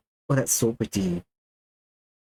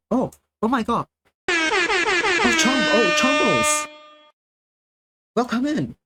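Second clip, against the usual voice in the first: excited; 12 semitones higher, 3 dB louder, slower than usual. Real, not acted. Raid arrives, excited greeting.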